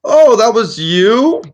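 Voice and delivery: put-on high-pitched, astonished old wizard; loopy friendly wizard. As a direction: marvel at their feat as a dotty old man. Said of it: "a little loopy friendly".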